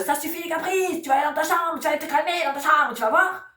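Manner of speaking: put-on high, sharp scolding of a child; stern scolding parent. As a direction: snap at the child to go to its room. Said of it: "à qui vous dites".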